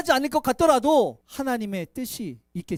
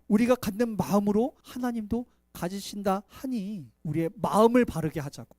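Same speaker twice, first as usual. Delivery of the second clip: ordinary talk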